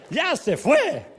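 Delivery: high, loud mock rejoicing; hammed-up gleeful shout; imitated jubilant pastor. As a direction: call it out like great news. Pastor's cheer repeated.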